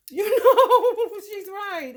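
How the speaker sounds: high, laughing through the words; laughing agreement. Real, not acted. Agreeing that she was right, laughing. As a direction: burst loudly into a laugh, then ease off.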